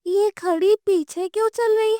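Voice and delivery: put-on high, nervous child's question; boy rattled by the backward clock; panicky. Boy asks why the clock runs backward, high and nervous. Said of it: "Aryan ने घबरा कर कहा".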